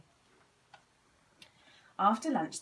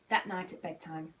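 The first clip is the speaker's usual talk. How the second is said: ordinary delivery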